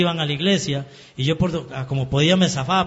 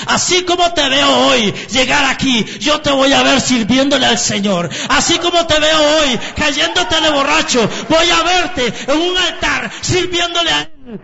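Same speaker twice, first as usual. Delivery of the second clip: much higher and louder, near shouting; impassioned prophecy; 10 semitones higher, 6 dB louder than usual. Retold or imitated. Sister's words over her drunk brother, voiced as a climbing shout. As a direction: cry it out like a prophecy.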